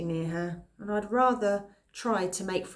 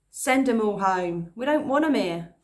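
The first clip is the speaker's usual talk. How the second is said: put-on jeering boy; 3 semitones higher, 6 dB louder than usual. Boy's mate telling the evacuees to go, louder jeer. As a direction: spit it out like a nasty schoolboy.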